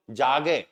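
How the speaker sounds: जागे with the final ए said as its own clear syllable; German pronunciation demo. Shown as a demonstration. Teacher models जागे for Hindi-speaking German learners, carefully. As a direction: say जागे slowly, sounding out the last ए. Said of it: "मुझे अलग से बोलो".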